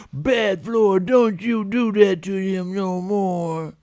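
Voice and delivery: high, loud, slow mock scolding of the floor; mock scolding mom. Mom blaming the floor for her child, loud high mock scold. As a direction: scold the floor like a doting mother, slowly.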